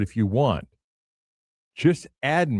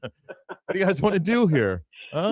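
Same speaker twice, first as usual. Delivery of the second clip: laughing mock question; 8 semitones higher than usual. Acting coy while guarding Kevin.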